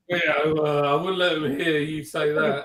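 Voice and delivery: ordinary talk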